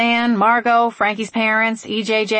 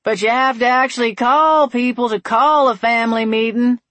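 louder, higher call after her; yelling; 5 semitones higher, 4 dB louder than usual. A narrator's reading. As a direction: yell up the stairs. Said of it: "he yelled".

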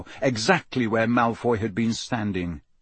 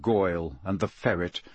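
plain reading voice

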